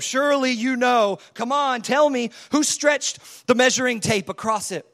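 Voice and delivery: mocking challenge, pushed harder; sarcastic God. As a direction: goad Job with heavy sarcasm. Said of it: "Look at God getting sarcastic with Job".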